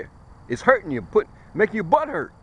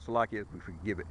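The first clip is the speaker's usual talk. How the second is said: mushy, low mumble with no teeth; toothless mumble; 10 semitones lower, 15 dB quieter than usual. Real, not acted. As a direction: slur "Salakia, we forgive it" as if toothless. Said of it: "Hard to talk when you got no teeth".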